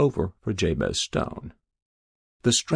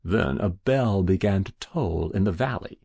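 usual speaking voice

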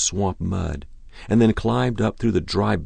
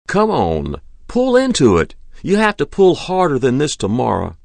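loud call to the horses in the dark; urging horses; 7 semitones higher, 5 dB louder than usual. A narrator's reading. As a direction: drive the team on with a raised voice. Driver urging horses with the whip.

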